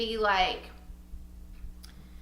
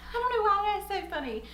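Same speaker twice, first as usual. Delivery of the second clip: high, laughing aside; amused; 8 semitones higher, faster than usual. Real, not acted. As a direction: say it through giggles. Amused at her own pronunciation.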